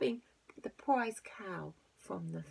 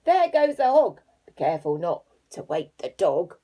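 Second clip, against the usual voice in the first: loud robber voice; 12 dB louder than usual. Acted. Robber speaks.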